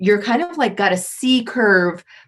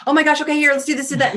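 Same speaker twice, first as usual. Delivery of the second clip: flustered hurry; 8 semitones higher, much faster than usual. Retold or imitated. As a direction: sound frazzled and in a rush. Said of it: "queens are not in a hurry".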